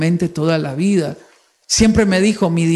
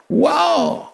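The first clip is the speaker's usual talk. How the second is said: mock wow; 9 semitones higher than usual. Sarcastic reaction to "obligation.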